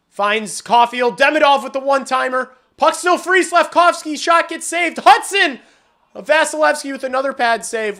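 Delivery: high, loud rapid play-by-play; excited play call. Real, not acted. Streamer calling a flurry of chances, loud and rising. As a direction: build through the flurry of shots. Live flurry in front of net.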